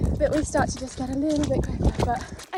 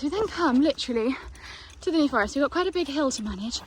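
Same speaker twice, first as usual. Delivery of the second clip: ordinary talk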